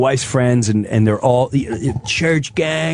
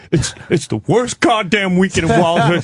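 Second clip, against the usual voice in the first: higher, mildly frantic venting; cop having meltdown; 5 semitones higher than usual. Acted. Cop venting about the Wildwood weekend, mock meltdown. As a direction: start to lose it, pitch rising. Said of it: "He starts having a meltdown".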